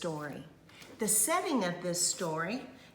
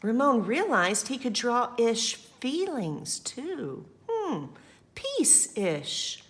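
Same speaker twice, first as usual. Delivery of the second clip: lively, louder read-aloud voice; story narration; 4 semitones higher, 5 dB louder, slower than usual. A narrator's reading. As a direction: read it to the children with energy.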